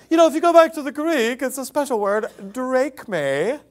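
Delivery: put-on know-it-all explaining the Greek; mock smart preacher. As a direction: parody a pompous preacher showing off. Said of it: "But he's like".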